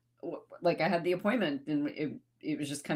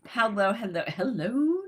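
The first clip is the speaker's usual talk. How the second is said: ordinary delivery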